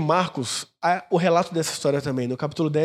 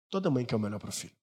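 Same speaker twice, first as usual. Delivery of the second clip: much softer, a bit quicker repeat; soft echo; 7 semitones lower, 9 dB quieter, faster than usual. Shown as a demonstration. Pastor echoes his point to the congregation, softly. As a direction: murmur it again to let it sink in.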